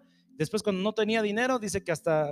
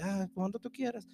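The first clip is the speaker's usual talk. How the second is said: soft, easygoing mock-noble voice; mock meek; 10 dB quieter than usual. Holy Spirit imagined as too polite, mock meek. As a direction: let it go softly, undemanding. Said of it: "una persona muy noble, así como que".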